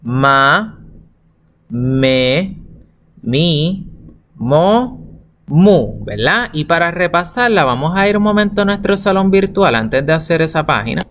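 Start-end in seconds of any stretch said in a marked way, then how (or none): 0.0-6.0 "ma", "me", "mi", "mo", "mu" each said slowly and clearly; syllables sounded out. Shown as a demonstration. Teacher models syllables for children, drawn out.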